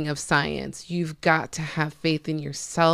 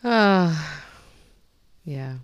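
ordinary talk, nothing marked

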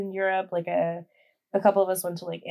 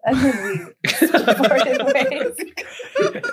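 slow, laugh-broken delivery; 5 semitones higher, 6 dB louder, much slower than usual. Real, not acted. Woman ending her flirting story, laughing. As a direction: laugh through the end of the story.

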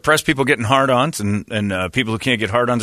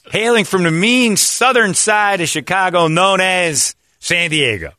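put-on slow, loud Chicago accent announcing; drawn-out Chicago accent intro; 9 semitones higher, 4 dB louder, slower than usual. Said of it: "where I got the accent".

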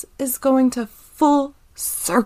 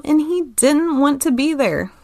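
normal talking voice

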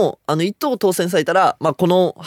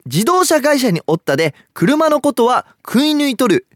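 a light Nagoya dialect reading of 「自動車会社におったで、車のことは食い抜いとる」, rising higher; Nagoya dialect example; 9 semitones higher than usual. Shown as a demonstration. Host demonstrating a Nagoya dialect word to his co-host, light Nagoya dialect. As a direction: read the line as a lightly voiced Nagoya local. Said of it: "みたいな感じで使います".

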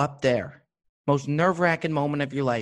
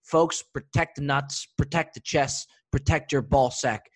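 ad read. Acted. Sponsor ad read.

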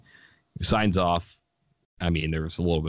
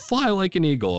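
exuberant call; 12 semitones higher, 4 dB louder than usual. Retold or imitated. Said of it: "He says".

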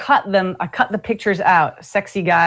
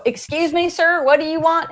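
imitated puzzled server; 8 semitones higher than usual. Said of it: "And they go".

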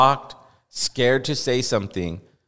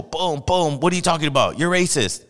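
mock rapid-fire interrogation, pitched up; imitated hard grilling in a higher voice; drilling interviewer; 5 semitones higher than usual. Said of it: "They're drilling him hard".

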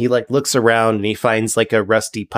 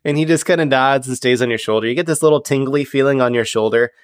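ordinary talk, nothing marked

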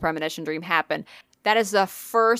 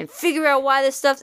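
higher mock-earnest investigator voice; Zach; 7 semitones higher than usual. Host imitating Zach's promise, higher mock resolve. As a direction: keep up the put-on determination. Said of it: "he's just like".

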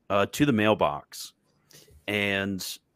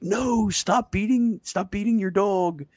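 high-pitched, drawn-out mock protest; mock distressed plea; 11 semitones higher, slower than usual. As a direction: cry out like a hammed-up horrified bystander. Acting philosopher pleading for the dog.

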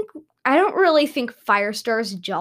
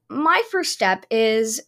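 usual speaking voice

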